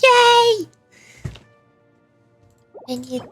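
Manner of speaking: usual speaking voice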